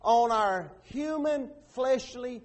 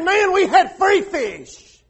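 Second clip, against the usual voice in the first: loud, slow, higher nostalgic sigh; mock longing; 8 semitones higher, 11 dB louder, slower than usual. Preacher mocking Israelites who miss free fish, loud and drawn out. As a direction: ham up the dreamy craving.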